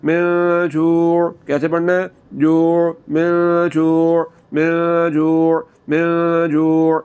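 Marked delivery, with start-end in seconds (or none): 0.0-1.3 very slow من جوع, noon hummed and ain from the throat; nasal noon, throat ain. Shown as a demonstration. Teacher drills من جوع with ghunna and throat ain. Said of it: "عین کی آواز کو آپ نے حلق کے درمیان والے حصے سے نکالنا ہے". 3.1-7.0 very slow من جوع, noon hummed and ain from the throat; nasal noon, throat ain. Shown as a demonstration. Teacher drills من جوع with ghunna and throat ain. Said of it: "عین کی آواز کو آپ نے حلق کے درمیان والے حصے سے نکالنا ہے".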